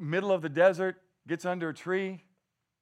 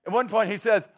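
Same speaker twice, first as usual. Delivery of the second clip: usual speaking voice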